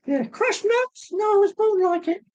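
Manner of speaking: Joe Pasquale squeak. Retold or imitated. Imitating the comedian's catchphrase in a very high squeak. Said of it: "don't ask me to try and imitate it".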